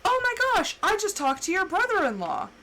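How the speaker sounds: bright high-pitched gush, lightly voiced; a hint of a gushing coworker; imitating coworker. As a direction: mimic the excited coworker breaking the news. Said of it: "she goes".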